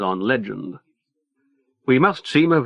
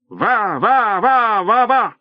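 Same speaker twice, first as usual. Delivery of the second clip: frantic shouting; 10 semitones higher, slower than usual. A narrator's reading.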